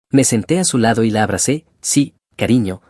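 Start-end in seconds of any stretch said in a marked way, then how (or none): none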